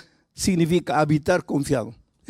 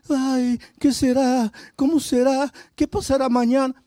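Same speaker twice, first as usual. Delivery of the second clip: mock worrier; 7 semitones higher than usual. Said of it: "Pero esa es otra actitud".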